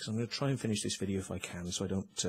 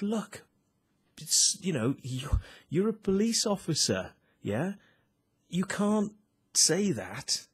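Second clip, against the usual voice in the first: insistent retelling; 7 semitones higher, 3 dB louder, slower than usual. Retold or imitated. Heated retold argument with policeman.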